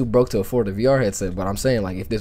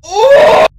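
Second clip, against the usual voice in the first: loud, high yelp of shock; startled whoop; 16 dB louder than usual. Real, not acted. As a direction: burst out in a sudden high cry. Jump scare in horror game.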